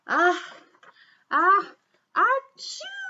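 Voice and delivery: building ah, ah, then a loud, high achoo; big sneeze. Acted. Storyteller acting the bear's huge sneeze, loud and high. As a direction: wind up and explode into a sneeze. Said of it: "makes the bear".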